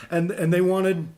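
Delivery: ordinary talk, nothing marked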